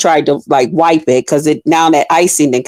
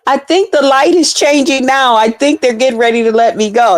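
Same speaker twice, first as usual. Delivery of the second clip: pleading driver; 7 semitones higher than usual. Retold or imitated. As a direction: beg them not to cancel. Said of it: "I said".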